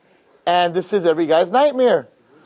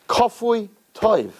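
ordinary delivery

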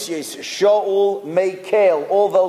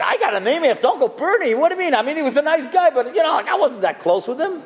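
exasperated protest; 7 semitones higher, faster than usual. Retold or imitated. Son protests to his father.

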